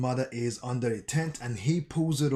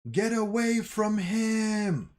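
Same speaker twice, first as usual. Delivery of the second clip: retold scream; 8 semitones higher, slower than usual. Gabi warning Falco away from Connie, a high drawn-out yell. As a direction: shout the warning to drive him off. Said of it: "someone screams".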